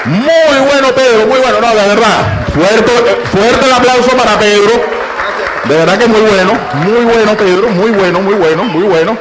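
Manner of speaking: llamado a gritos, agudo y fuerte; anímalo al público, bien arriba; host shout. Real, not acted. Host calls applause for Pedro. (el presentador pide aplausos para Pedro, a gritos)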